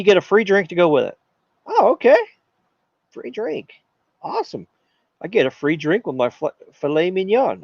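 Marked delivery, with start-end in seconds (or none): 1.7-7.6 delighted diner. Pretend. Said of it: "And you say".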